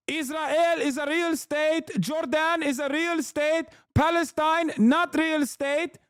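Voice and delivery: high-pitched, hammed-up Arabic-accented impression; mock Arabic accent. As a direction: parody the guest in a thick Arabic accent. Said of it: "He just sounds like that".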